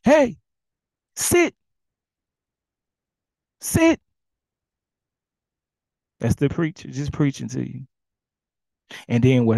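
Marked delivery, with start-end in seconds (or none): 0.0-4.0 high, loud sit; firm owner command. Retold or imitated. Owner ordering his pit bull to sit, high and loud. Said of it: "And calmly".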